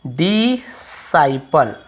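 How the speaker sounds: डिसाइपल drawn out slowly in separate parts; डिसाइपल with a long "sai", pause, then "pal"; syllable-by-syllable pronunciation. Shown as a demonstration. Teacher showing students how the word is read, डिसाइपल slowly split up. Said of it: "पढ़ते हैं".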